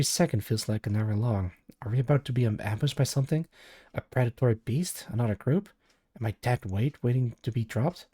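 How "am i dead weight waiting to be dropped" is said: usual speaking voice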